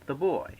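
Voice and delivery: put-on "the boy" with "the" slack and "boy" stressed; stress on second word. Shown as a demonstration. Teacher demonstrating an iamb, stress on "boy" in "the boy". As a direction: lean on "boy" in "the boy", "the" unstressed. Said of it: "followed by a stressed syllable".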